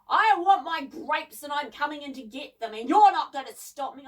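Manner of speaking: loud, defiant yell. A narrator's reading. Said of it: "he yelled".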